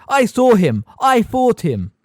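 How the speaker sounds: loud, high insistent cry; loud protest. A narrator's reading. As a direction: hammer each claim home. Harry shouting at teacher.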